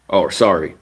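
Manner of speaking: normal talking voice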